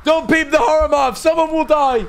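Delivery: high, loud shout of alarm; hammed-up, high-pitched alarm; frantic warning. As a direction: yell a panicked warning at the screen. Frantic warning to man on screen.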